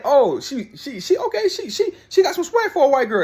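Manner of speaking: hammed-up amazed fan voice; mock fan gushing. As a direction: gush it out half-laughing like an impressed fan. Mocking how fans see Clark.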